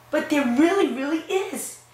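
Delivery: high, pleading insistence; insisting child. Acted. Boy insists to his disbelieving parents, high and pleading. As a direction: plead that it's true.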